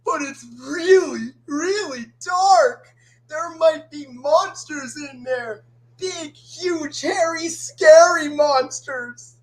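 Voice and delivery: high, wailing fear, drawn out; scared cartoon character. Scared character facing the dark forest, high fearful wail. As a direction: whine in mounting fright about monsters. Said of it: "now it's my turn".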